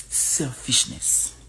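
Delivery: slow whispered "Selfishness". Real, not acted. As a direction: breathe out the word slowly in a whisper. Condemning African selfishness.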